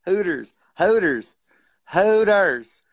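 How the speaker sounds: honked out like a car horn; car horn imitation. Imitating her accent with a mock car horn. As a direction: blare each word high and loud like a horn. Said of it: "it's like a car horn".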